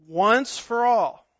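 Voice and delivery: once for all spaced out slowly; slow emphasis. Shown as a demonstration. Pastor stressing a Bible phrase to his flock, slowly. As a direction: drag out once for all for weight. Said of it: "Notice that expression".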